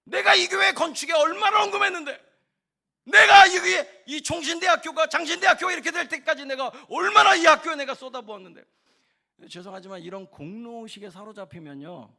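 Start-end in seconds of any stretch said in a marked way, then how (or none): 0.0-8.6 high, very loud indignant shout; 분개한 호통. Acted. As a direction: bellow it like someone owed respect. 헌신을 내세우는 사람의 분노를 연기. (억울해서 버럭 지르는 고함)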